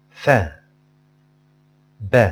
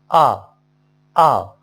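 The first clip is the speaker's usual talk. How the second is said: normal talking voice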